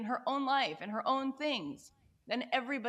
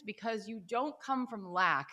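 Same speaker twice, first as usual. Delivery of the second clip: ordinary delivery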